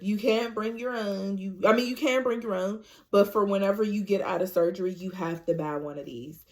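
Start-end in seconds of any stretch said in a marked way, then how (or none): none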